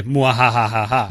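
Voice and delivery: slow villainous mwahaha; evil laugh. Pretend. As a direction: cackle like a mad scientist.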